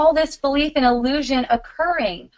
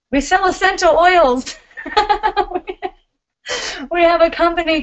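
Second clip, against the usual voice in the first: laughing plug; 7 semitones higher than usual. Real, not acted.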